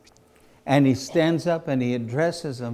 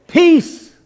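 much higher, louder, forceful command; Jesus commanding the storm, shouting; 14 semitones higher, 10 dB louder than usual. Acted. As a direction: rebuke the wind with authority. Jesus rebukes the wind.

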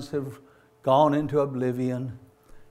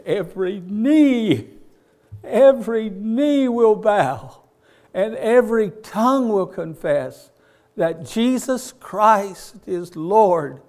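loud, high, slow preacher's cry; preaching at full force; 7 semitones higher, 10 dB louder, slower than usual. Real, not acted. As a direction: proclaim it to the whole congregation. Preaching climax, shouted.

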